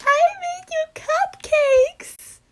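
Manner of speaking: very high, loud, stretched-out cutesy cheer; excited gift-giving squeal. Acted. Precious friend at the dorm door with a treat, excited squeal. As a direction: play the sweet friend bursting with joy. Voicing sweet character with a gift.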